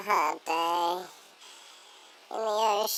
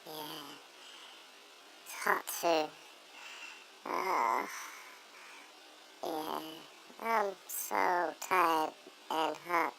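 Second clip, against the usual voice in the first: sleepy; 3 semitones lower, 11 dB quieter, slower than usual. Real, not acted. Drowsy, nearly asleep.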